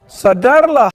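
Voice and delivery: shouted wake up; loud command. Real, not acted. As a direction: yell it sharply. Officer shouting at suspect.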